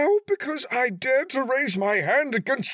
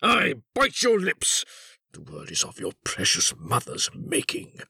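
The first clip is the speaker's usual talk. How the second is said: furious retort; 8 semitones higher than usual. A narrator's reading. Father insults son and mother, furiously. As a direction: lash out, then bring the voice down.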